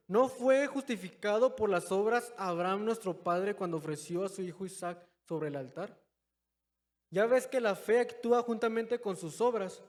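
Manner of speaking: loud, emphatic preacher reading the verses; preaching scripture reading. Acted. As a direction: proclaim the scripture to the congregation. Reads scripture aloud forcefully.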